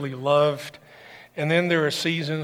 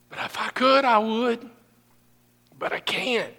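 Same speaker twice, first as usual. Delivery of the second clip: grieving, wistful; 3 semitones higher than usual. Retold or imitated. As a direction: let the grief pull the words down. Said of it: "Julie and I have often said".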